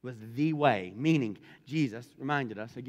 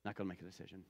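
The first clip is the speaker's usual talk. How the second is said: mock casual refusal, dropped softer and lower; dismissive brush-off; 7 semitones lower, 13 dB quieter, much faster than usual. Judge putting off Paul's case, quietly dismissive. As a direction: shrug it off quietly, as if imitating him. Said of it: "he just pushes it off and goes".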